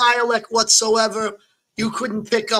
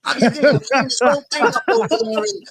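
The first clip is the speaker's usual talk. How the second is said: hammed-up East Coast wiseguy accent, pitched up; Joe Pesci; 2 semitones higher than usual. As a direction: play a mock mobster with a thick Rhode Island accent. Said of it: "you'll see Joe Pesci".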